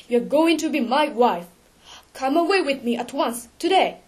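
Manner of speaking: loud, insistent urging; urgent command. A narrator's reading. Raoul begging Christine to come away, urgent command. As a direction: demand it firmly and urgently.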